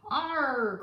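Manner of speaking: loud pirate crew cheer; crew's cry. Acted. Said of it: "cried the crew".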